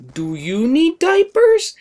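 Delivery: put-on high, slow, teasing old-lady voice; teasing grandma. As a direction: draw it out to tease the kid. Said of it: "She looks right at me and goes".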